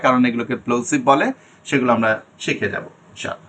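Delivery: normal talking voice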